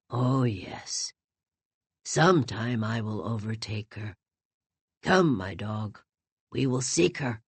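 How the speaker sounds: put-on old man resolving to keep seeking his bride; resigned old man. A narrator's reading. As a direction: speak with quiet resignation. Grieving old man leaves with dog.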